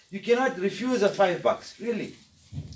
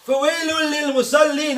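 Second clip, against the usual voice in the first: higher, louder warning cry; shouting the verse; 6 semitones higher, 5 dB louder, much faster than usual. Shown as a demonstration. Preacher voicing the warning to those who pray, loud. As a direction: proclaim the woe. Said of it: "Allah is shouting".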